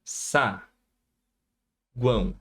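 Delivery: "Sa, guão" with a long pause between syllables. Shown as a demonstration. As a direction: separate it cleanly into "Sa" and "guão". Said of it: "vamos lá".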